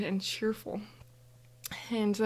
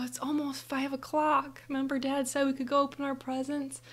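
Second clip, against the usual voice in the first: higher-pitched acted child pleading; eager reminder; 5 semitones higher than usual. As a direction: coax a sleepy sister, lightly acted. Acting child persuading sleepy sister.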